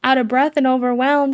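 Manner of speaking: overwhelmed. Real, not acted. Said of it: "if I'm a little".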